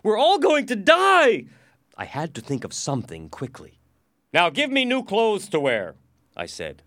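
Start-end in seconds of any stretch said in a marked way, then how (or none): 0.0-1.5 high, loud, panicked yell; crowd shouting. A narrator's reading. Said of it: "people were shouting". 4.3-6.0 loud, high, authoritative order; commanding demand. Acted. New minister gives orders.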